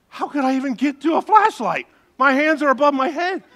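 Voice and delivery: indignant can't-be; incredulous protest. Retold or imitated. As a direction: protest in disbelief.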